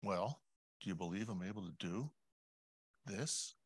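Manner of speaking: very soft, gently rising question; hushed, tender asking with a pause before the last word; still small voice. Acted. God answering a praying mother, in a still small voice. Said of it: "that still small voice coming back and saying".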